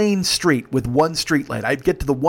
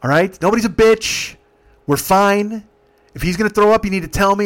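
loud, higher, laying down the law; stern raised voice; 5 semitones higher, 5 dB louder than usual. Retold or imitated. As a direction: break up a fight firmly. Driver stops the passengers' fight.

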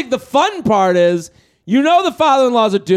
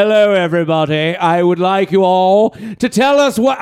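lightly done posh British voice; a hint of a stuffy British host; British accent; 5 semitones lower than usual. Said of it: "I don't know why he's British".

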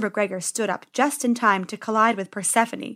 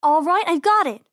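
excited idea; 6 semitones higher, 5 dB louder than usual. Acted. Penny finds a plan.

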